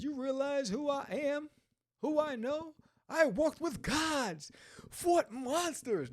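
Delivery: high, slow, puffed-up boasting; arrogant boast. Acted. Villain bragging to the heroine, pompous slow boast. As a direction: lord it over them, slowly. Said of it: "Now he's on his high horse".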